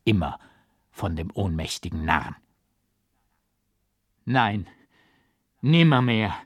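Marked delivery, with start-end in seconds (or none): 4.2-6.3 a loud, heated no; loud, vehement refusal; fierce refusal. A narrator's reading. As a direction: shout it down hard. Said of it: "schrie ich heftig".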